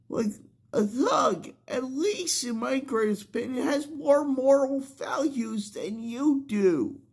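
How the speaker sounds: high, heated scolding at the viewers; outraged rant. Real, not acted. Outraged rant at cheering crowd.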